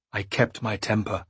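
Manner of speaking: ordinary delivery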